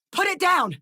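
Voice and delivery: high, forceful yell; high-pitched battle shout; shouted combat order. Acted. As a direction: bellow the kill command to the squad.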